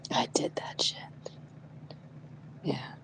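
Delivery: whispered confession. Real, not acted. Whispering to her friend about the scratched warhammer. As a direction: breathe it out like a secret.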